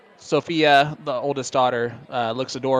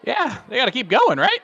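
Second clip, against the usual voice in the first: much higher, incredulous check; voice shooting up; 12 semitones higher, 4 dB louder than usual. Real, not acted. Commentator doubting the players know the match continues, high-pitched disbelief. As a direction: let disbelief push the pitch way up. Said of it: "my voice went up eight".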